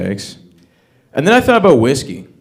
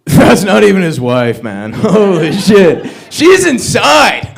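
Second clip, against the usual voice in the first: caption acted out; 6 semitones higher, 8 dB louder, much slower than usual. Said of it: "a caption that reads".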